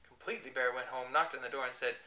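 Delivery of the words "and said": ordinary delivery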